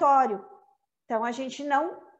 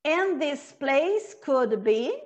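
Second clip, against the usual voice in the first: slow, measured recital with long pauses to repeat; poem recitation; slower than usual. Shown as a demonstration. Teacher speaks lines for students to repeat, slow poem recitation. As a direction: recite it line by line, unhurried. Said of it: "a gente vai pensar em recitar".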